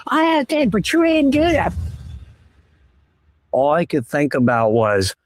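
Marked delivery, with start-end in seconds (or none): 0.1-1.7 high, fast ranting wife voice; exasperated wife imitated, pitched way up; mock ranting wife. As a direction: rattle off her complaints in a high shrieking mimic. Wife ranting at him, retold.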